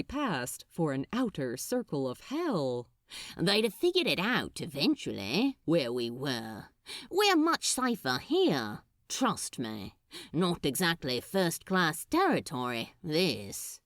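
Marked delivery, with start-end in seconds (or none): 3.4-13.8 lightly voiced easygoing demon, reassuring; a hint of a confident demon calming his partner. A narrator's reading. Crowley reassuring his companion.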